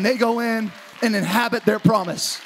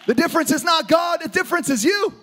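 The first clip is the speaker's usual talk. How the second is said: preacher calling out the crowd; preaching shout; 8 semitones higher than usual. Real, not acted. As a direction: confront them head-on. Preacher exhorting congregation.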